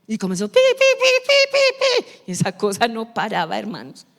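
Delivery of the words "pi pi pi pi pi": high loud imitated beeps; machine beeps. Preacher imitating the store machine beeping nonstop. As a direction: beep beep like the register.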